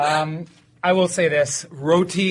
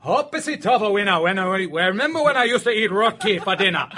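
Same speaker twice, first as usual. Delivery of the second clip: put-on thick Newfoundland accent, higher and laughing; a playful Newfoundland accent; 4 semitones higher than usual. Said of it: "my Newfoundland accent".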